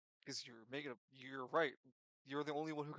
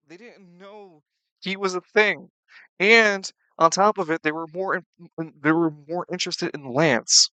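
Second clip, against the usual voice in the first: raised, emphatic voice; loud insistence; 3 semitones higher, 21 dB louder than usual. Real, not acted. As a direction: hammer the point home loudly. Player arguing loudly.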